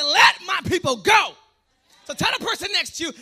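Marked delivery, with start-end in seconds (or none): 0.0-1.4 loud, drawn-out rallying cry; a booming, stretched-out demand; emphatic Puerto Rican shout. Shown as a demonstration. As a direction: shout it slowly with full conviction. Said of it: "You gotta say".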